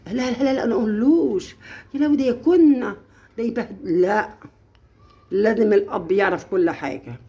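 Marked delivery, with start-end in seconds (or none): 0.1-4.0 higher mock protest; a hint of maternal panic; mock worried mother. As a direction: play a fretful mom lightly. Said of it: "يبقى أبوها".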